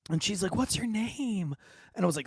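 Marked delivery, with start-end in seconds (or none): 0.6-1.5 put-on high, softer old lady voice; super old lady. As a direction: ask sweetly like an old lady. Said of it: "this super old lady".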